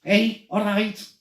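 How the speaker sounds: slow Scouse greeting; Scouse accent. Shown as a demonstration. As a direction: drawl a Scouse hey all right. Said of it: "the Scouse accent".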